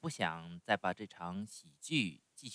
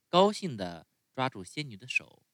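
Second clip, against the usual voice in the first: usual speaking voice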